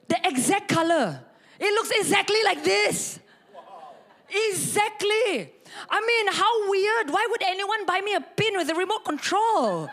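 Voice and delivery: high, emphatic astonishment; amazed. Real, not acted. Preacher showing congregation the matching pin, high amazed exclaiming. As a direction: marvel loudly at an uncanny coincidence.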